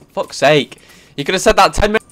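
loud, high complaint; frustrated outburst, voice jumping up; exasperated. Real, not acted. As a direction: burst out in annoyance. Exasperated at forgotten bag.

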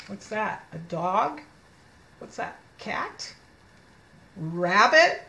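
usual speaking voice

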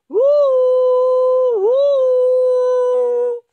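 two long high, loud hoots; echoed owl hoot. Acted. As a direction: hoot back as the well's echo. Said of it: "il pozzo rispondeva". (eco acuta del grido del gufo)

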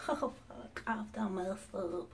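soft, slow dismay under breath; dismayed mutter. Real, not acted. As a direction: mutter the mistake to yourself. Makeup mistake dismay.

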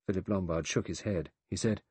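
plain reading voice

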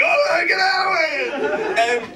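panicked cry. Retold or imitated. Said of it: "He was like".

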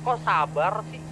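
usual speaking voice

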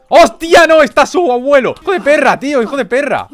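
startled yelling. Real, not acted. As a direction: burst out yelling in shock. Grandfather found in the house.

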